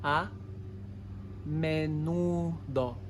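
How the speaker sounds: A, pause, then a slow menudo; two words, slowly. Shown as a demonstration. Teacher showing the two words, slowly. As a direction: say A and menudo apart, dragging menudo. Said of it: "It's two words".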